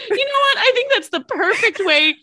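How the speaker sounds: loud laughing while talking. Real, not acted. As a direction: laugh through the words. Laughing after silly word game.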